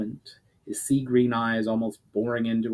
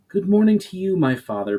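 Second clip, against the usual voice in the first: beaming; 9 semitones higher, 5 dB louder than usual. Acted. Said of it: "beamed Myra".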